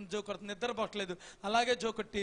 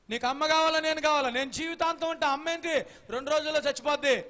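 higher and louder, pushing an ultimatum; loud demanding challenge; 4 semitones higher, 5 dB louder than usual. Acted. Wife loudly demanding her husband pick her over mother. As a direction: demand he choose, voice raised.

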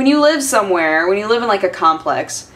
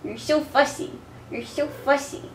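soft cooing; 5 semitones higher, 10 dB quieter than usual. Real, not acted. Cooing to the cat.

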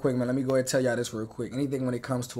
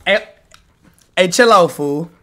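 shouting; 4 semitones higher, 14 dB louder than usual. Real, not acted. Streamer yelling at the clip.

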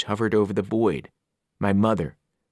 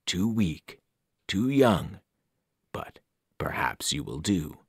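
low, fading into a whisper; an ancient evil weighing its prey; cold ancient voice; 4 semitones lower, 4 dB quieter, slower than usual. Acted. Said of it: "The voice felt ancient, cold, and heavy".

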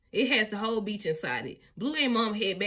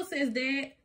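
plain reading voice

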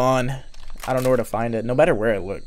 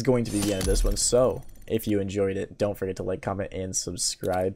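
YouTube outro; 6 semitones lower, 8 dB quieter than usual. Acted.

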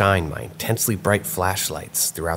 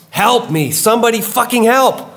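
ear-splitting, high scream for help; screaming right outside the window; explosive shriek; 8 dB louder than usual. A narrator's reading. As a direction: explode into a full-force shriek. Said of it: "the voice explodes into the cabin".

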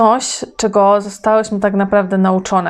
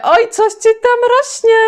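much higher, teasing squeal of a joke; mocking tease; 13 semitones higher, 3 dB louder than usual. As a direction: tease her in a high, mocking voice. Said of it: "Ktoś sobie zażartuje".

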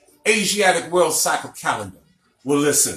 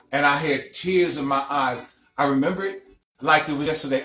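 usual speaking voice

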